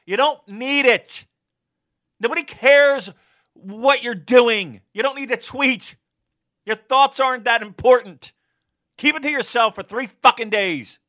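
yelling rant. Real, not acted. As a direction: yell at the whole internet. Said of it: "Old man yells at tree".

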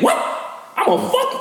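high-pitched mock outburst; fly off the handle in imitation; overhyped, squeaky comeback; too hype. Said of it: "a little too hype".

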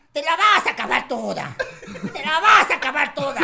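loud, gruff mock dad; loud imitation of the father; dad's voice. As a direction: bellow it like the dad mid-act. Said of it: "mi papá le decía".

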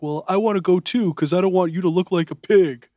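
hammed-up Bam teasing his wife; mocking Bam. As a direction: sneer it like a bratty husband. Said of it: "And Bam's like".

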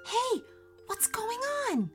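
very high, startled cry; panicked crook, pitched right up; robber's shout. A narrator's reading. Robbers attacked in the dark, alarmed high cry. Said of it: "the robbers were shouting and grunting".

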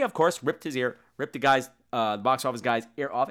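ordinary talk

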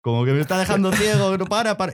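mock pleading to stop the flash; mocking dazzled man. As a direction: act out the dazzled man half-jokingly. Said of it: "haciendo esos movimientos de".

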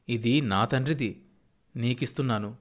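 usual speaking voice

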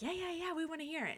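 high, keen agreement without extra volume; eager friends. Retold or imitated. Voicing friends keen to hear the song, eager. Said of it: "they were all on board".

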